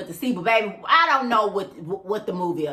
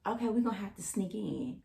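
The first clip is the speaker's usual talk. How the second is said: hushed, much softer aside; imitated whisper; 11 dB quieter than usual. Date confiding a plan to sneak in, mock whispering. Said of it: "He whispers to me".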